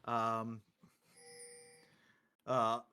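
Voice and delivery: normal talking voice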